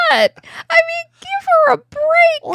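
very high, loud exasperated squeal; worked-up, high-pitched protest; exasperated plea. Real, not acted. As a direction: plead for her at the top of your range, dragging out the last words. Exasperated plea on the queen's behalf.

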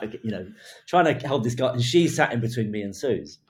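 usual speaking voice

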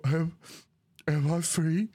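bewildered freed prisoner. Acted. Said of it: "looks around, go".

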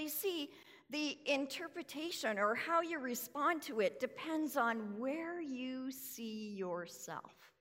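usual speaking voice

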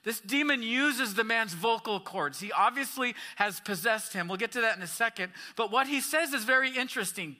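ordinary talk, nothing marked

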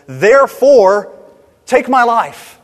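loud mock-indignant outburst, 'therefore' drawn out; exasperated prayer. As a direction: hit it hard, like an absurd conclusion. Acting Jonah's exasperated prayer to God.

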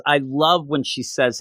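ordinary talk, nothing marked

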